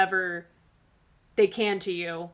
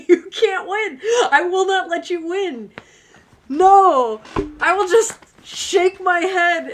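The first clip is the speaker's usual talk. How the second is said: louder, higher, laughing mock defiance; hammed-up stubborn vow through giggles; 6 semitones higher, 7 dB louder, slower than usual. Reader imagining facing her person, loud laughing mock defiance. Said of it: "And I'm like".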